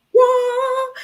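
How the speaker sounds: high, loud disbelieving squeal; shocked what. Retold or imitated. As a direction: shriek it in disbelief. Shocked kid in story.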